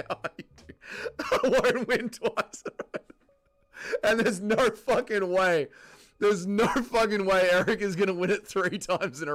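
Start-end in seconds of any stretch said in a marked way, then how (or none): 1.2-3.0 laughing while reading it out; amused laughing read. Real, not acted. 4.0-8.3 hammed-up wail; mock crying. Mock anguish over winner.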